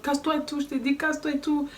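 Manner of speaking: imitating his high yell from the window; mock yell. The man shouts from his window, a mock high yell. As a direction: throw the man's shout back, half-mocking. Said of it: "il commence à me crier dessus".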